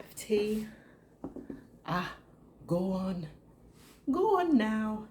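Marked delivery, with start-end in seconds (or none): 1.9-5.1 hammed-up Irish accent, coaxing, pitch jumping up on the last go on; badly faked Irish lilt, urging someone on. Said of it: "that's the worst Irish accent ever".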